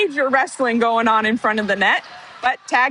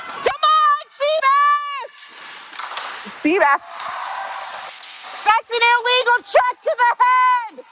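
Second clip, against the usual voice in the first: much higher, fan yelling at the ice; excited shout; 12 semitones higher than usual. Real, not acted. Commentator cheering her player, then protesting the hit, shouting high. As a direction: yell it like a worked-up home fan. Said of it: "I'm getting worked up".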